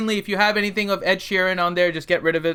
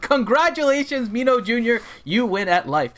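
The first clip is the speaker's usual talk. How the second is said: excited; 6 semitones higher than usual. Real, not acted. Cheerful congratulations to kid.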